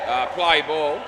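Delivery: loud call to start play. Real, not acted. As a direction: call it out across the court. Umpire-style call to start play.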